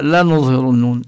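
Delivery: ordinary talk, nothing marked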